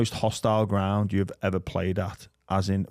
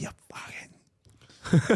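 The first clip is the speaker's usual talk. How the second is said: slow, breathy mock insult under the breath; whispered, drawn-out mock abuse; whispered heckle; 9 semitones higher, much slower than usual. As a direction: hiss it slowly like a muttering fan. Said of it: "But, like".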